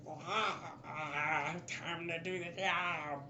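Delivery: slow, drawn-out creature voice; lizard man. Acted. Game master voices lizard man popping out, slow creature voice. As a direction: put on a gangly little lizard man, unhurried. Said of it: "lizard man".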